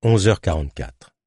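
plain reading voice

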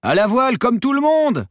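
very high, loud yell over an engine; full-lung shout. A narrator's reading. Protesting sailor over motor noise, shouting. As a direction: shout at the top of your lungs. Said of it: "criait-il à plein poumon".

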